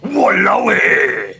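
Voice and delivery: slow, loud, drawn-out Walao eh; amazed Singlish. Shown as a demonstration. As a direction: shout Walao eh in awe. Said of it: "so extreme and grim and brutal".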